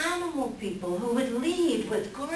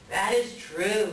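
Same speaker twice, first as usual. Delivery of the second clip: ordinary talk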